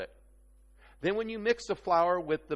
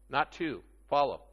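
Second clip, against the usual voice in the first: ordinary talk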